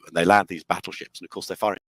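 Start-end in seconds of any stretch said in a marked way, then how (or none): none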